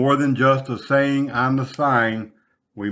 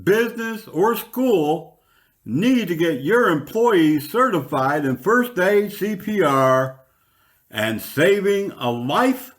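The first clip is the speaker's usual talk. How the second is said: higher, upbeat sponsor-ad voice; ad read; 4 semitones higher than usual. Acted. Radio host pitching first aid classes to listeners, upbeat ad read. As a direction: sell it like a local radio spot.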